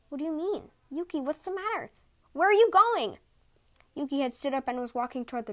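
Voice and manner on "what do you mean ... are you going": alarmed. A narrator's reading. Husband alarmed as wife leaves.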